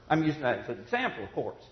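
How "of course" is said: usual speaking voice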